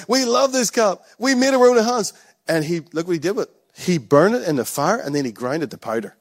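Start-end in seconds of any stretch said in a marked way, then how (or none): 0.1-2.1 higher, proud mock gushing; gushing crowd voice. Mocking the Israelites, a high gushing voice. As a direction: play the proud calf makers, high and pleased. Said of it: "they were like".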